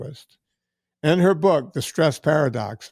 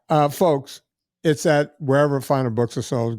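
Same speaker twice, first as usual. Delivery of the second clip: ordinary delivery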